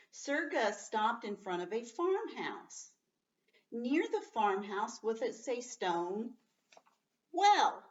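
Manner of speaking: teacher highlights "well" while reading to the class; story reading. Acted. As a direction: land on the key word.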